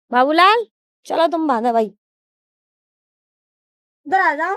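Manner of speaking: loud call across the yard. Real, not acted. Calling Babulal over to tie the animal, loud call. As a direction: call him over loudly from a distance.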